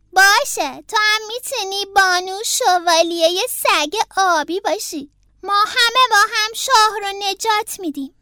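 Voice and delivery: put-on high, loud child voice; Franklin the agreeable leader. A narrator's reading. Franklin lets Beaver be a knight too, high and loud. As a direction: grant it like a playmate taking charge.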